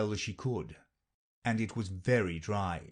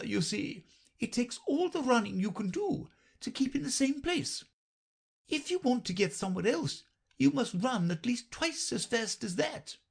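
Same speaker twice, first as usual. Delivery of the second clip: very high brisk queen; matter-of-fact and a little impatient; 13 semitones higher than usual. A narrator's reading. Queen answers Alice, very high and brisk.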